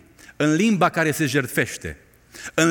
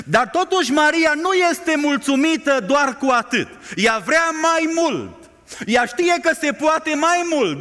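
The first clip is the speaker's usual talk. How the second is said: preaching at full force; 9 semitones higher than usual. Acted. Preacher driving home Mary's longing to the congregation, fervent. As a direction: build the plea with fire.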